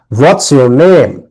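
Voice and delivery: slow, name falls; falling question tune. Shown as a demonstration. Teacher shows a wh-question, slow falling tune. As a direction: let name drop at the end. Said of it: "কণ্ঠ নিচে নামবে".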